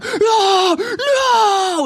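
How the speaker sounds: sudden high, loud wail of despair; coach's anguished cry. Retold or imitated. Soccer coach watching the ball miss the net, anguished yell. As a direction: cry out like a coach whose team just missed. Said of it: "and he's just like".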